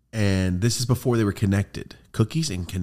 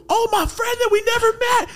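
very high, loud squeal of recognition; light up on recognizing him; excited realization; 6 dB louder than usual. Retold or imitated.

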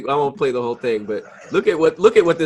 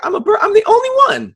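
much higher, goofy mock voice; imitating the bird watcher; 4 dB louder than usual. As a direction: send up the bird watcher in a silly squeak. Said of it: "he was like".

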